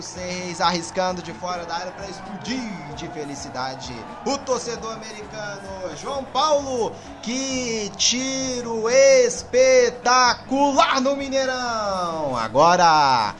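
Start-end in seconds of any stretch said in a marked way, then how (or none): 6.0-12.4 long drawn-out shout of praise; explosive goal commentary. Real, not acted. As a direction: stretch each word, shouting.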